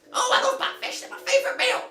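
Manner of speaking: put-on high, cracked old man squeak. GM voicing the old lake hermit, high old man voice. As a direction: play the naked old hermit, high and quavery. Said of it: "He goes".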